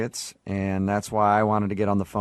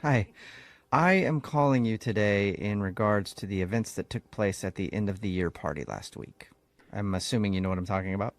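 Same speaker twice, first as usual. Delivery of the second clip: softer, formal HR voice; hint of an HR officer; 4 dB quieter than usual. Prank host poses as Human Resources calling, soft and formal. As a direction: speak as a cool HR caller.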